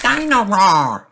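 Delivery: put-on high, slow toddler call; a tiny boy calling his toy; George's voice. Toddler wanting his toy in the bath, high slow call. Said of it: "says George".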